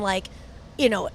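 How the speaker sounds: ordinary talk, nothing marked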